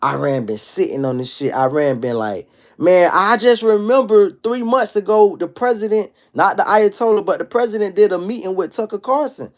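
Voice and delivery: ordinary talk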